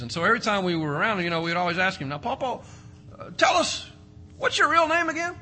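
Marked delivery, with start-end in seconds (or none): 2.1-5.3 put-on high, eager kid asking grandpa; childlike asking voice. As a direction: ask like a curious little grandkid.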